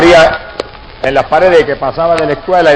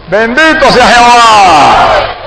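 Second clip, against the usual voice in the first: stretched out, raised voice, praising God; 5 semitones higher, much slower than usual. Real, not acted. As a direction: lift it up and draw out every word. Preacher exclaims praise to congregation.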